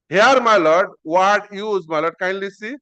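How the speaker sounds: ordinary talk, nothing marked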